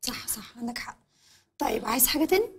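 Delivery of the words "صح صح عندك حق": breathy whispered agreement. Acted. Fiancée whispering agreement to the man who dropped by. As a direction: drop to a hushed whisper.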